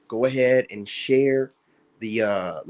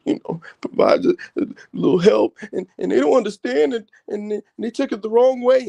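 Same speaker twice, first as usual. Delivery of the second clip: much higher, put-on wounded voice; high-pitched mock of a woman's complaint; mocking whiny woman; 10 semitones higher than usual. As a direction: mimic a hurt woman playing innocent. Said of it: "And it's like".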